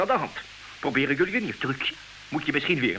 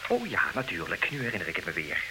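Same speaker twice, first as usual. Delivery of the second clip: much lower and softer, taken aback; hushed realization; 12 semitones lower, 8 dB quieter than usual. Acted. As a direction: let the penny drop quietly. Suddenly remembers the rope, chilled.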